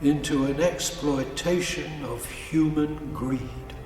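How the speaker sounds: normal talking voice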